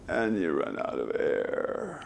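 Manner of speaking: low, soft creaky fry; vocal fry. Shown as a demonstration. Voice coach demoing vocal fry at sentence end. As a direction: let the end creak out of air. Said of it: "This is where you end the sentence".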